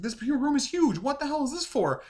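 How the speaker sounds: high-pitched astonished exclamation; imitated incredulous outburst; mock amazement. Acting out amazement at huge room.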